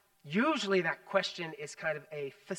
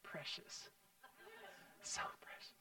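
breathy whisper of relief; hushed, tender whisper; relieved whisper; 12 semitones higher, 21 dB quieter than usual. Retold or imitated. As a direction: whisper as if the child might vanish. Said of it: "I'm just like".

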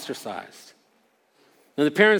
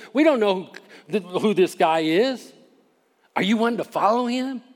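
higher-pitched, incredulous questioning; mock indignation; 5 semitones higher than usual. Pharisees retorting to the healed man, indignant and incredulous. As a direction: throw it back in disbelief.

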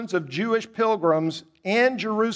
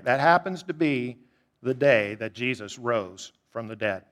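ordinary delivery

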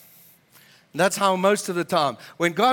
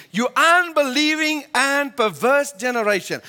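acted irritation, raised pitch; irritated rebuke; 6 semitones higher, 3 dB louder than usual. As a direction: rebuke them like an exasperated master. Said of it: "a little bit irritated".

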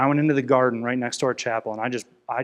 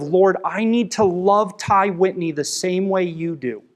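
pleading prayer; 5 semitones higher, 5 dB louder, slower than usual. Retold or imitated. Retells his desperate prayer.

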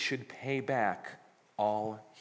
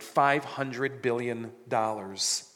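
"five hundred billion dollars" spelled out very slowly; preacher stretching the huge number; slow emphasis; much slower than usual. Shown as a demonstration. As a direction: drag out each word of the sum for effect. Stressing an absurd sum.

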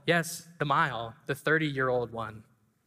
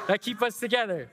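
choked up, higher; tears catching the last words; real, a pastor moved to tears at the close; voice breaking; 6 semitones higher than usual. Real, not acted. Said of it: "I could not say it".

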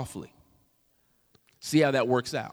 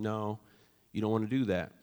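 spoke softly; 5 semitones lower, 7 dB quieter than usual. Retold or imitated. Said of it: "I spoke softly to him".

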